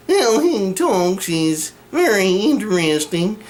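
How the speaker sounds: slow, nasal, whiny cartoon sheriff; Sheriff of Nottingham voice. Shown as a demonstration. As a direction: speak with a nasally twang. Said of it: "the Sheriff of Nottingham".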